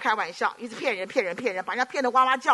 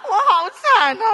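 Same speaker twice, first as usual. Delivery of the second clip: higher, louder, slow hammed-up lament; mock wail; 10 semitones higher, 10 dB louder than usual. Preacher caught by the Cantonese-speaking father, mock lament. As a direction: wail it as comic misery.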